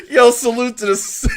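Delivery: loud, high laughing shout-out; hyped shout to the fans. Real, not acted.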